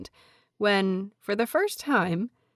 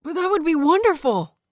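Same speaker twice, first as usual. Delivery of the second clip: much higher, louder joyful outburst; bursting out; 10 semitones higher, 6 dB louder than usual. A narrator's reading. Disciple bursting out with delight at the teacher news. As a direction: blurt it out with delight. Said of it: "Lan Sijui bursts out".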